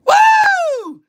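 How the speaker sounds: excited whoop. Real, not acted. Hyping up Detroit joining.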